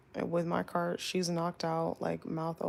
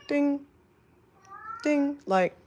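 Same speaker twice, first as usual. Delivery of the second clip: high sung-out ding ding like a cabin bell; imitated call chime; 9 semitones higher, 5 dB louder, much slower than usual. Attendant imitating the call bell she heard, high chime. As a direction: mimic the two chimes, high and bright in pitch. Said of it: "it was a ding".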